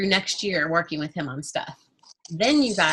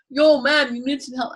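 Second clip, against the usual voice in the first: bad street accent; 6 semitones higher, 3 dB louder than usual. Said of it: "I don't know how to do the accent".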